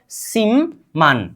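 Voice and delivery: सिमन drawn out slowly, split as sim-man; demonstrated pronunciation. Shown as a demonstration. Teacher asks learners to say it, slow model pronunciation. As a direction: hold the m of सिमन, then land man. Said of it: "बोलिए तो".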